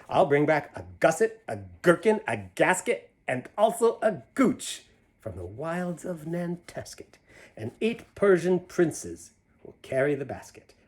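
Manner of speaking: lively rhyming storybook read; reading to children. A narrator's reading. Reading a picture book aloud to children, lively. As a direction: bounce through the rhyme for the kids. Reads Seuss rhyme aloud.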